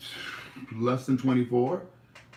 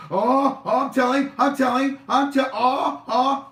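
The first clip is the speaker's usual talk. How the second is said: put-on high, loud, childish tattletale cry; loud sing-song I'm telling; mock tattling; 13 semitones higher, 8 dB louder than usual. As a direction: whine it like a kid about to tell on someone.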